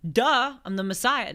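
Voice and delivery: jokey, eye-rolling Jesus; mock sarcastic Jesus. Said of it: "No, I'm kidding".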